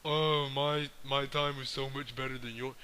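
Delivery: mocking imitation of a smug old-timer; put-on know-it-all voice, mimicking a boastful veteran; arrogant old head. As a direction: parody the old heads' superior attitude. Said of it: "lost the attitude of".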